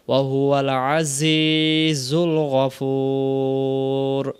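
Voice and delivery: slow, drawn-out tajweed chant; Quran recitation. Shown as a demonstration. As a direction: recite it like a qari, very slowly. Recites the verse. (ধীর তেলাওয়াতের সুরে)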